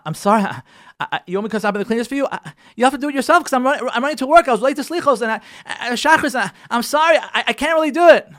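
hurried excuses. Retold or imitated. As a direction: rush out excuses to your wife. Husband brushing off wife, in a rush.

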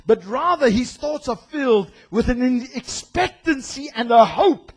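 ordinary talk

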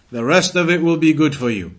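ordinary talk, nothing marked

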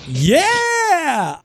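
high thrilled cheer; excited yell. Retold or imitated. Fan cheering the shock moment, high joyful yell. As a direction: whoop like a fan at a big win. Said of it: "But now it's like".

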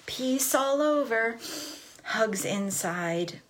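slow, stretched-out blessing; slow ritual phrase. Retold or imitated. Family before nursery drop-off, slow ritual chant. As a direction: draw out each half with a pause between.

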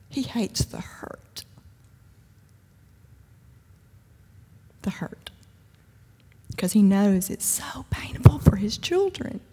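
slow, hushed and tender; tender whisper. Real, not acted. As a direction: lean in and whisper the comfort. Tender whispered emphasis in sermon.

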